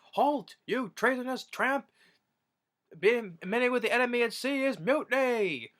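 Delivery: very high, hammed-up mock commander; pompous captain. Jealous husband playing captain, condemning her in a hammed-up high voice. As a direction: ham up a self-important captain's decree.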